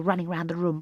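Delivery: usual speaking voice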